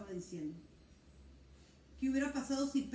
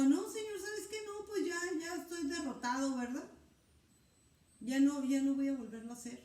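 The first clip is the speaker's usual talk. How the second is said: high, beaten-down refusal; a tired fisherman telling the Lord he quits, high and defeated; defeated whine; 4 semitones higher, 4 dB louder than usual. Acted. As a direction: sound like you have given up. Pedro voiced giving up.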